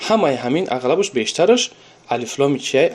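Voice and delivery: ordinary talk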